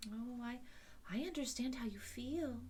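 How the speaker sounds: put-on little girl. A narrator's reading. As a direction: sympathetic girl. Girl's lines voiced.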